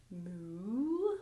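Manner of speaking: soft low moo like a lazy cow. Acted. Yoga teacher showing cow pose to kids, soft moo. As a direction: moo gently, like a cow in the field. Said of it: "This is cow".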